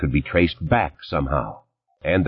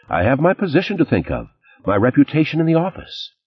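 rising indignation; 9 semitones higher than usual. Acted. Lauren rising about her reputation.

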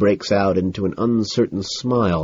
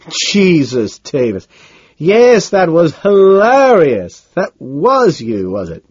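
high, loud, drawn-out delight; hammed-up hilarity, slow and swooping on the big words; amused Harold Carnes; 9 semitones higher, 7 dB louder, slower than usual. A narrator's reading. Carnes to Davis about the message, highly amused. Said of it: "finally laughs".

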